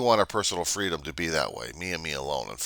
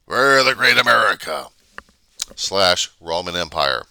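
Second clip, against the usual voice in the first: mock grand proclamation; 8 dB louder than usual. Vlogger mocking America as the Roman Empire, loud. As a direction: declare it like an empire.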